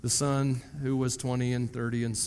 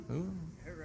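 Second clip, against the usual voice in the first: whispered hooah; 3 semitones higher, 13 dB quieter than usual. Shown as a demonstration. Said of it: "No, it's not oo".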